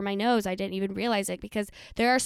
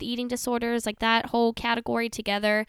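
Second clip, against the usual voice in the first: normal talking voice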